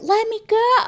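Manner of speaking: squeaky high-pitched let me go; mock high plea. Girlfriend grabbed by the arm, squeaky mock plea. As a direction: plead high and thin to be let go.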